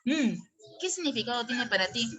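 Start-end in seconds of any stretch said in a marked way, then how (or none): 0.0-0.3 "mm" as a bare humming M; isolated M sound. Shown as a demonstration. Teacher showing students the M phoneme, "mm" hummed alone. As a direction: pronounce only the sound of M, "mm". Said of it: "y la pronuncio".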